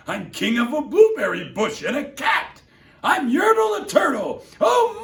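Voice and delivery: high, loud, bragging royal shout; boastful turtle king. Acted. Yertle boasting of all he rules, loud and proud. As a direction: puff up like a vain king. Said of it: "Yertle cried".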